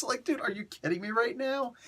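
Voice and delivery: like you just read something absurd; incredulous disbelief. Real, not acted. Reacting to a shocking comic twist.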